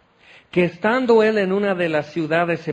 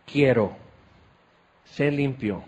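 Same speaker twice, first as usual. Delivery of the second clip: tender healing word; 3 semitones lower than usual. Acted. Jesus heals the leper.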